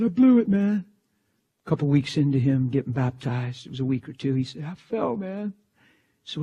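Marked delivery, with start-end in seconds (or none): 0.0-0.9 tearful confession, pitched up; imitated crying. Friend calls preacher in tears, imitated crying. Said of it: "crying his eyes out". 4.6-5.5 small, high tearful voice. Retold or imitated. New believer confessing to preacher, imitated crying. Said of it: "crying his eyes out".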